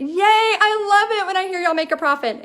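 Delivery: high, delighted cheering; excited cheer. Real, not acted. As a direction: celebrate someone's good news out loud. Cheers a viewer's profit news.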